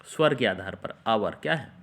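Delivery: usual speaking voice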